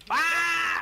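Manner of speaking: loud, high-pitched yell; sudden piercing scream at a mishap. Real, not acted.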